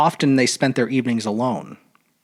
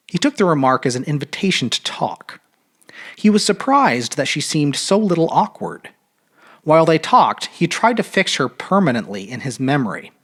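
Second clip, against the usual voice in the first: ordinary delivery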